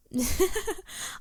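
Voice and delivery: high, sheepish giggle; embarrassed giggle. Acted. Traveler admitting to the host he sleeps in a coffin, giggling. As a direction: laugh off the awkward confession.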